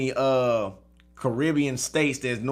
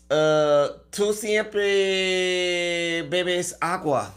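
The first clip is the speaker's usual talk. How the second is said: mock Spanish-accented character; 5 dB louder, slower than usual. Put-on old Spanish-speaking character.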